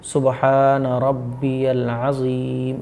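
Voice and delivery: रब्बियल with a clear यल and अज़ीम with a soft ज़; careful teaching recitation; slow, correct pronunciation. Shown as a demonstration. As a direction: slowly recite सुबहान रब्बियल अज़ीम, each sound exact. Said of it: "इस तरीके से".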